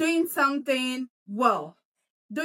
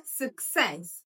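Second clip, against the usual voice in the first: "success" as suk-SESS, weak schwa then stressed SESS; stress on second syllable; 4 semitones lower than usual. Shown as a demonstration. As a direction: land the stress on the end of "success". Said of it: "The stress is on the second syllable".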